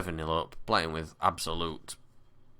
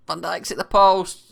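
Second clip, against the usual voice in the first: excited; 13 semitones higher, 12 dB louder than usual. Real, not acted. Near miss in game.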